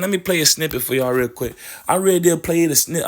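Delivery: ordinary talk, nothing marked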